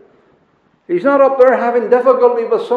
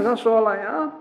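ordinary talk

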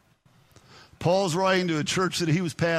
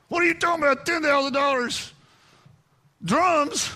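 shocked objection; 8 semitones higher than usual. Retold or imitated.

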